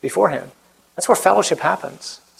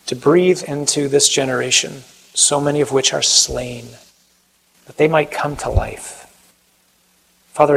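closing prayer; 3 semitones lower than usual. Acted. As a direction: pray gently to the Father.

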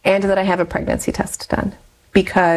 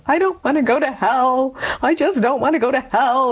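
mock sobbing plea; 6 semitones higher than usual. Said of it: "sobbing in our bathroom".

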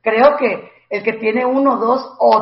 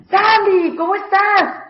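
bright, high friendly hello; cheerful greeting; 10 semitones higher, slower than usual. Retold or imitated. Friends arriving and greeting Sandy, high and cheerful. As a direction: chirp it like a friend dropping by.

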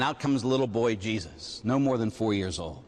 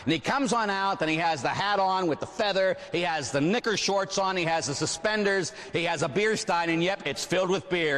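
ordinary talk

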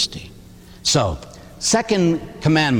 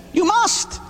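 MUST pushed much higher, rising; insistent must. Real, not acted. Priest urging sinners to confession, emphatic must. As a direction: lean hard on must. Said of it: "You must go to confession".

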